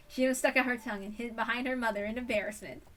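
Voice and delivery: ordinary delivery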